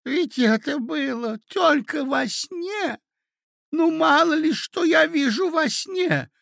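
high, slow, loud protest; flustered old prince. Acted. Prince excusing his dream to the ladies, flustered. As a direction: plead in a piping old man's voice.

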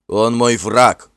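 медленный, громкий яростный рык; Финн в гневе клянётся, раздельно и громко; рычит. A narrator's reading. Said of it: "прорычал Финн". (прорычать с гневом)

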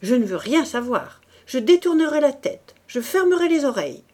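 loud refusal rising in pitch; violent refusal. A narrator's reading. Priest shuts out the woman's confession, shouting violently. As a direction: cry out to drown her confession. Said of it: "cria-t-il plus violemment".

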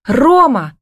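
high, urgent cry of his name; shouted call. A narrator's reading. Lost woman calling her partner, shouting. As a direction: call out for him in panic. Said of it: "закричала, что было мочи".

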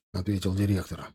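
plain reading voice

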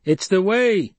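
high, loud crowd shout. Acted. Crowd member insisting on tradition, shouting. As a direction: yell it from the crowd. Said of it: "someone shouted".

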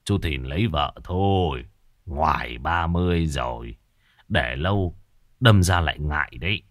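put-on low old neighbour's voice; low, measured elderly counsel; old man advising. A narrator's reading. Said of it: "Ông Cải thường nói với tôi".

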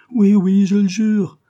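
high, loud sob-broken oath; sobbing cry. A narrator's reading. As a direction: swear it through sobs. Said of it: "d'une voix entrecoupée par les sanglots".

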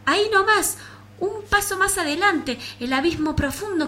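dramatic narration. A narrator's reading. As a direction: build the danger.

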